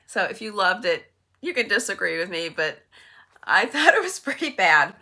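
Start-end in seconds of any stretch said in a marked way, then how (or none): none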